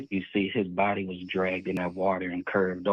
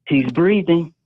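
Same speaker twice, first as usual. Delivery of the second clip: raised, louder quote of a man; quoted man's exclamation; 9 semitones higher, 6 dB louder, slower than usual. Retold or imitated. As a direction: imitate the man calling it out. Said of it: "that's when that man said".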